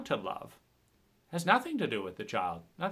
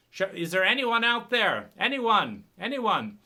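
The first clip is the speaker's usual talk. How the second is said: louder, higher call into emptiness; 6 semitones higher, 5 dB louder than usual. Pretend. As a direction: call out as if no one answers. Calling out for help.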